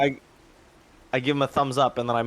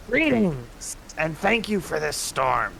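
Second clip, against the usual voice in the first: calling out; 4 semitones higher, much slower than usual. Acted. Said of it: "and I'll yell out".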